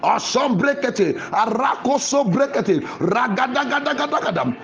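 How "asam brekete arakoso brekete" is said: normal talking voice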